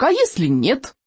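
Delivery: high, put-on squeaky question; squeaking. A narrator's reading. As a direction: squeak the worry out. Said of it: "пискнул Рыбьенок". (тревожный писк)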